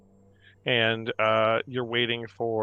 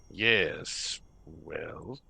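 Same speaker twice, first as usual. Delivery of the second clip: low, soft professor voice; lightly voiced, hesitant orb; talking orb; 7 semitones lower, 7 dB quieter than usual. Orb starting to introduce itself, low and soft. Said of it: "And the orb says".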